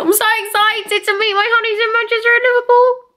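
telling fans about the meetup, squealing; excited squeal. Real, not acted. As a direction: squeal it out with glee. Gushing about meeting fans.

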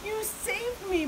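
high, amazed cry. A narrator's reading. Big Bark thanking his rescuers, crying out. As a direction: exclaim it high with relief. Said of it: "Big Bark cried".

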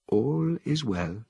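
lightly voiced Helios; calm ape scientist. A narrator's reading. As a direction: soothe them slowly.

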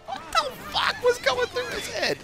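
squeaky disbelief. Real, not acted. Baffled rant about Q-Tip name.

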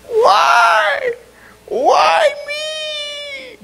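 high, loud wailing cry of why me. Retold or imitated. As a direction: imitate a hurt skater's wail. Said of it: "she just cried".

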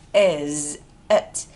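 slow "Is it" with a gap; separate words. Shown as a demonstration. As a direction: pull "Is" and "it" apart. Teacher sounds out separate words.

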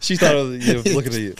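laughing. Real, not acted. Laughing while recalling the moment.

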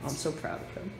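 soft, moved, near tears; proud and choked up. Real, not acted. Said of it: "I'm crying".

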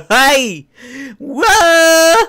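high, loud hype yells; high, loud whooping; excited shouts. Pretend. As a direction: hype up the crowd. Hyped intro shouts.

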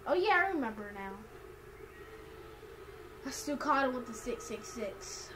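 ordinary talk

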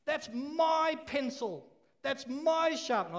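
high, slow whiny mine-mine voice; a toddler grabbing back every toy; greedy child. Retold or imitated. Preacher mimicking kids claiming things as theirs, high and slow. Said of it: "Who here sometimes says".

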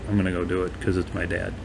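low, soft, quick aside; quiet self-talk. Retold or imitated. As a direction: murmur it to yourself, relenting. Son talking to himself in parable.

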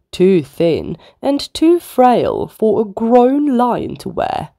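ordinary delivery

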